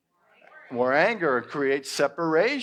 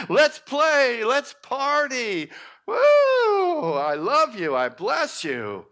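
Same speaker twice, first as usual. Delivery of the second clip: high, loud party welcome with laughter; joyful welcome; 11 semitones higher, 5 dB louder, much slower than usual. Acted. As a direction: throw the door open gleefully. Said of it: "that kind of an attitude to say".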